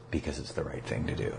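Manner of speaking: very soft and low, matter-of-fact; quiet retold answer. Wife explaining to husband why they'll pay, quietly imitated. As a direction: drop right down for the wife's gentle answer.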